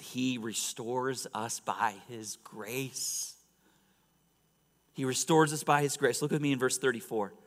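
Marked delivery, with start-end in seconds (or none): 0.0-3.4 drawn-out, measured emphasis; slow, deliberate point. Real, not acted. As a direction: lay out each word slowly for the congregation. States third point, then repeats it.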